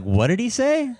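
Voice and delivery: high-pitched mock bewildered bystander; puzzled customer. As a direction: ask it high, imitating a baffled passer-by. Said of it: "people going like".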